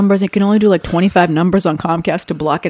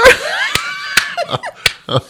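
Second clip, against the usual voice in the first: shrieking laugh. Real, not acted. Laughing about heart doctor call.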